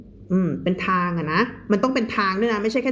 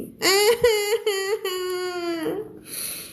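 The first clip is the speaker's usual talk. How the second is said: shrill high laugh breaking out; 10 semitones higher than usual. Real, not acted. Teacher laughing at the tragic story turn, high laugh. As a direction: burst out laughing at the twist. Laughing at sad plot twist.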